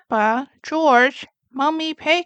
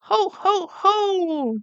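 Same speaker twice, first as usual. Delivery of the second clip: higher jolly ho ho ho; hearty chuckle; 7 semitones higher, slower than usual. Acted. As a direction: chuckle like a pleased dad. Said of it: "chuckles Daddy Pig".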